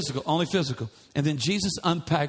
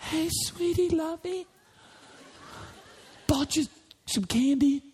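really nice; 9 semitones higher than usual. Retold or imitated. Husband sweet-talking an upset wife, high and syrupy. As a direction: coo at her like a sweetheart. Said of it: "I'll try to be, like, really nice".

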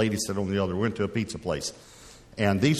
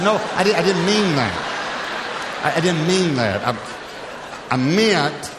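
flustered backpedal; 7 semitones higher, 5 dB louder, slower than usual. Retold or imitated. Flustered protest to pastor.

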